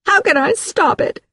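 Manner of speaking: very high-pitched tearful plea; distraught wail of a question; crying. Acted. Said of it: "she was crying".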